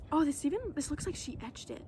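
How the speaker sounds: usual speaking voice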